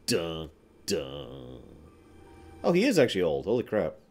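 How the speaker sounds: ordinary talk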